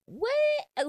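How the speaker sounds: high, loud squeal of disbelief; a stunned, squeaky what; shocked what. Real, not acted. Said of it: "I was shook".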